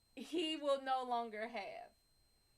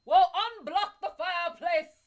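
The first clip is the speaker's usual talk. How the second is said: loud, higher hammed-up hotel worker; mock staff voice; 4 semitones higher, 10 dB louder than usual. Staff reporting to the boss, hammed-up and loud. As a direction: play the flustered staff calling out. Retelling staff explaining blockage.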